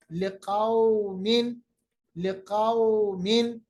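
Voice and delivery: फलौला and लिकौमिन slow, vowels soft; soft drawn vowel. Shown as a demonstration. Teacher drills words slowly for class. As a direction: draw out each au gently. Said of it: "कौम नहीं".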